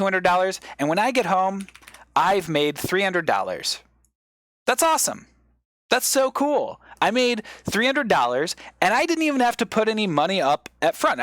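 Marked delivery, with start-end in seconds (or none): 4.7-11.2 excited gloating. Pretend. Imagined trader gloats over profit.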